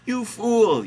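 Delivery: very high, loud rebuke; Mary yelling. A narrator's reading. Host reading Mary rebuking God, yelling. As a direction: yell it in a high-pitched voice. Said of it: "yell Mary".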